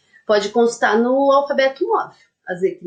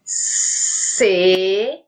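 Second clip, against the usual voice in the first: slow, drawn-out "Segredinho" split into syllables; syllables of "Segredinho" pulled apart slowly; 2 semitones lower, much slower than usual. Shown as a demonstration. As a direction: stretch "Segredinho" for dictation. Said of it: "quantas sílabas tem a palavra segredinho?".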